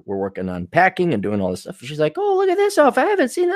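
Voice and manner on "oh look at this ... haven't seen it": mock girlfriend. Voicing girlfriend.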